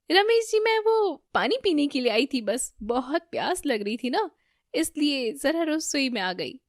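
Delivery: high, nervous excuse; flustered. A narrator's reading. Damini explaining herself to Ramesh in the kitchen, flustered. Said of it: "Damini काफी घबरा जाती है".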